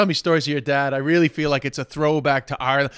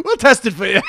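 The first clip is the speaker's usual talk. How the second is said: higher, giggling repeat; laughing cop voice; 7 semitones higher, 4 dB louder than usual. Pretend. Listener repeating the cop joke, laughing.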